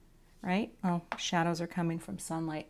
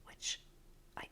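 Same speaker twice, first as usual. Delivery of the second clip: soft breathy ASMR whispering; 21 dB quieter than usual. Shown as a demonstration. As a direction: whisper close like an ASMR video. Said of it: "my A S M R voice".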